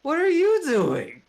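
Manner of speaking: high, loud and slow; startled angry outburst; alarmed angry demand. Acted. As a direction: yell it at the spellcaster. Xerxes demands angrily.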